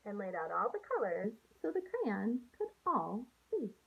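put-on gentle storybook read-aloud for young students; picture book read to a class; reading to children. Teacher reads picture book aloud.

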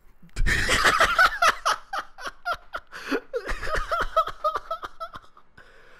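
high, long burst of laughter; laughing. Real, not acted. Laughing after his gangster wizard joke, real laughter. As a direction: crack up helplessly.

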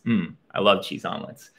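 low cousin voice. Acted.

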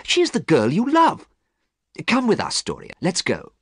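high, urgent plea to a friend; high-voiced urging, settling at the end; crying out. A narrator's reading. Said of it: "cried Basil".

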